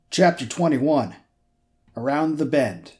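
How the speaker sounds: slow, announced chapter title; chapter heading. Acted. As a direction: announce it slowly.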